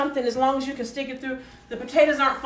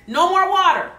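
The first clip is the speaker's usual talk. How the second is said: higher and louder, stress on "more"; emphatic no; 7 semitones higher, 8 dB louder than usual. Real, not acted. Cook warning viewers, louder and higher, stress on more. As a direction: lean hard on "more" in "No more water".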